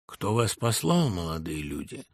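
dignified. A narrator's reading. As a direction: ask with unhurried dignity. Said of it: "с достоинством спросил Жухов". (с достоинством, неторопливо)

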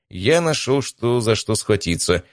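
plain reading voice

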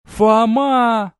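loud high cry of joy; joyful shout. A narrator's reading. Verona running to Foma, loud joyful cry. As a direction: shout his name as you run to him.

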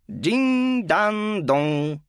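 lively, high PA announcement; cheerful announcer. Acted. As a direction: chirp it into the microphone. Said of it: "оживлённый женский голос".

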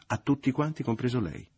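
loud, high-pitched bitter outburst; bitter, sarcastic outburst. A narrator's reading. Mother including Leo in her scorn, bitter. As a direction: burst out with cutting sarcasm. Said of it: "proruppe amara e sarcastica".

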